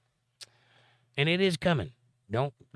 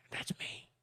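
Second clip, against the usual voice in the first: breathy unvoiced whisper, barely audible; 14 dB quieter than usual. Real, not acted. Streamer whispering a cheeky aside to chat, hushed. Joking aside after lumberjack quip.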